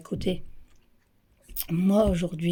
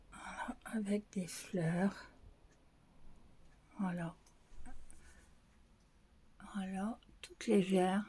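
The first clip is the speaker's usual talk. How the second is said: whispered; 13 dB quieter than usual. Real, not acted. Describing the flowered blouse to viewers in a soft whisper. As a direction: hush it while showing the blouse.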